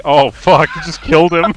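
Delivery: ordinary delivery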